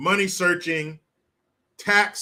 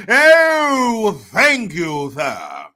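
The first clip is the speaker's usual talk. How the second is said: mock grovelling gratitude; 2 semitones higher, 7 dB louder, much slower than usual. Driver thanking the leader for gas prices, loud mock grovelling. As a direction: stretch the "Oh" into servile thanks.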